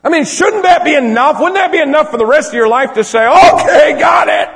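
high, loud, pleading preacher; impassioned preaching. Real, not acted. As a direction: plead at full force, building to a shout. Preacher pleads at full force.

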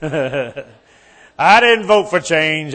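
slow, laughing through the words; chuckling denial. Real, not acted. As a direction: laugh it out as you protest.

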